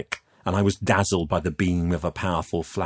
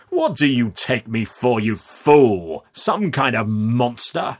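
higher, incredulous scoff; scornful amusement; 5 semitones higher, 5 dB louder, slower than usual. Acted. Man scoffs at youth's terror, scornful. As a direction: punch FOOL loudly, mocking the idea. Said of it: "booming laugh thundered around the vault".

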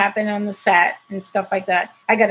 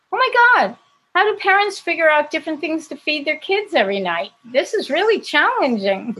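much higher, overwhelmed exclamation; 7 semitones higher than usual. Retold or imitated. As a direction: cry out in higher-pitched dismay. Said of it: "once I said".

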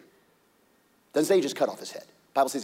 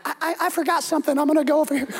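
high, fast stammering dodge; nervous armor bearer; 12 semitones higher, 5 dB louder, much faster than usual. Acted. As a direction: stutter out an excuse and slip away. Armor bearer voiced fleeing.

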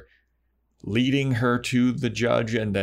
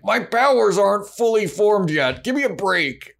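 loud, high mock whine of excuse; hammed-up defensive outburst, pitched way up; exasperated protest; 12 semitones higher, 5 dB louder than usual. Demon defending his unfinished powers, mock exasperated. Judge defends himself, retold.

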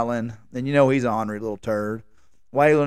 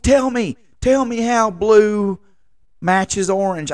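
drawn-out incredulous demand, higher; exasperated; 7 semitones higher, 3 dB louder, slower than usual. Real, not acted. Husband baffled by wife's color advice, exasperated. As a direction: stretch it out in disbelief.

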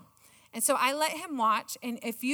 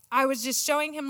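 ordinary talk, nothing marked

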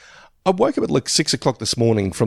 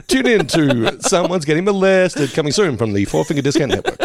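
hammed-up TV announcer plug; mock network promo; 6 semitones higher than usual. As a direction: sell it like a channel promo.

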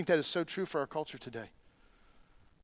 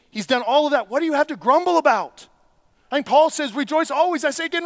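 much higher and louder, pressing the crowd; preaching at full force; 10 semitones higher, 9 dB louder than usual. Acted. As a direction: rail against grumbling at full volume. Rebukes grumbling, rising fervour.

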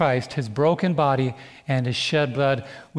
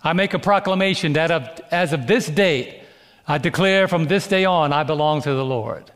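town crier; 3 semitones higher, 3 dB louder than usual. Retold or imitated. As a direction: cry it out like a herald. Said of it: "it's kinda like this back in the day".

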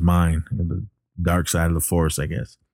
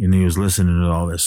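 normal talking voice

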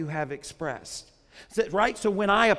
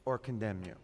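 much softer and lower; hushed close; 11 semitones lower, 11 dB quieter than usual. Real, not acted. Preacher ending the verse to the congregation, hushed. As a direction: drop it to a grave hush.

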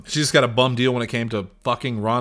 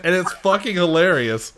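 ordinary talk, nothing marked

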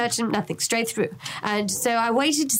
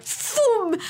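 whoosh sound; 12 semitones higher than usual. Retold or imitated. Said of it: "he's just like".

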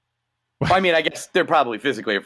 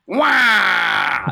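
muffled wah wah talk; 4 semitones higher, 3 dB louder than usual. Pretend. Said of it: "Featuring the voice of a recently departed Burgess Meredith".

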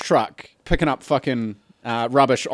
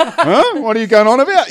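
high, loud, can't-hear-you query; acted-out puzzled dismissal; mock bafflement; 9 semitones higher, 4 dB louder than usual. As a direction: call it out as if you missed every word. Acting himself lifting an earmuff, baffled.

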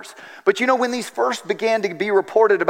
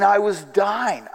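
normal talking voice

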